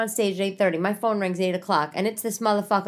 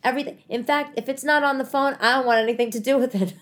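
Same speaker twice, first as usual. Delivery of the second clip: normal talking voice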